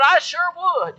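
put-on high, loud, delighted little old lady; eager old lady. Preacher voicing elderly Tony to Rob, a high eager old-lady shout. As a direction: squeal it out like a thrilled grandma.

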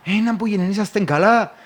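imitating the other crew asking if everyone is okay; concerned co-driver. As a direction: ask if they're alright with alarm. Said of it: "θα σου πει".

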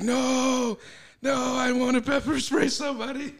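high, loud wailing yell; retold drunken protest. Drunk guy refusing to go home, mock yelling.

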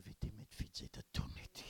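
hushed secret challenge; whispered retort. Pretend. Preacher acts out whispered retort.

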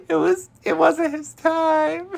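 very high, loud mock wailing; hammed-up bawling. Recapper voices Hannah losing it over Manny, hammed-up bawling. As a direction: wail melodramatically in a squealing fake sob. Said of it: "She starts bawling her eyes out".

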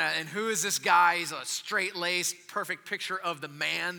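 ordinary talk, nothing marked

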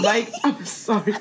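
high, slow, through laughter; laughing apology. Real, not acted. Apologising to a friend for the insults, laughing. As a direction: crack up as you apologise.

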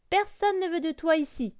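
loud, high-pitched scolding; scolding goose. A narrator's reading. Goose rejects the duckling, scolding. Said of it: "gronda l'oie".

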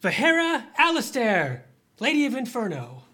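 high, loud ceremonial call; herald's announcement. Acted. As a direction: announce her arrival to the hall. Herald announces her entrance to hall.

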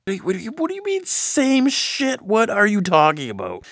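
shrill incredulous outburst; high, fast, outraged disbelief; indignant. Real, not acted.

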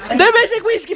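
high-pitched demand in mock alarm; play-acted desperate demand; mock outburst. Acted struggle over the whisky.

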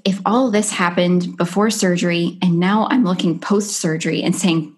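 ordinary talk

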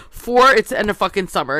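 loud, exasperated last point of a list; emphatic demand. Retold or imitated. Listing complaints at the tailgate, loudly insistent.

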